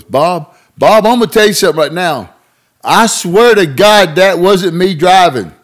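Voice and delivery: high, loud mock pleading oath; buddy swearing innocence. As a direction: swear it big and high like a country boy.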